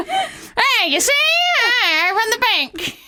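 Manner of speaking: high, hammed-up old-movie banker; rich banker voice. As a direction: growl it like a greedy banker from an old film. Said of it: "the rich fat guy".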